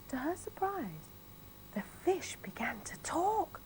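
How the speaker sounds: whispered storytelling; hushed suspense. A narrator's reading. Narrator whispering to children as the fish talks.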